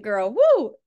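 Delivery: loud cheering whoop; loud delighted shout-out; excited whoop. Real, not acted. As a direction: hype her up with a big woo. Cheering the Southern accent.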